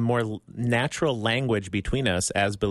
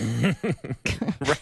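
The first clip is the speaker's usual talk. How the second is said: laugh; 4 semitones higher than usual. Real, not acted. Host's story amuses him.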